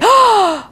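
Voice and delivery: sharp high gasp of surprise; shocked gasp. Retold or imitated. Men react in shock.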